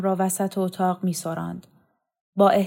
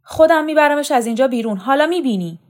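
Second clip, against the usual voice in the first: much higher and louder, fast and defiant; defiant vow; 6 semitones higher, 7 dB louder, faster than usual. Acted. Son vowing to take his sister away, defiant shout. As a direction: spit the threat out quickly.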